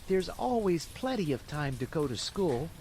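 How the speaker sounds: lightly voiced carefree puppet; a hint of a rascal making excuses; Pinocchio's voice. A narrator's reading. Puppet deciding to skip school, carefree.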